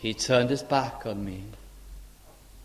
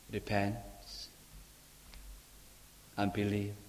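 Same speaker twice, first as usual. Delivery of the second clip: soft command; 4 semitones lower, 14 dB quieter than usual. Acted. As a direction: say it gently, almost under breath. The Lord's soft answer.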